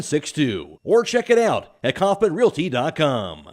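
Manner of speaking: emphatic, lifted real-estate ad voice; ad read. Acted. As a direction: read the listing like a radio spot.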